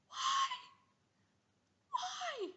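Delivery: very high squeal; exasperated cry. Pretend. Annoyed friend shrieking why at the blabber.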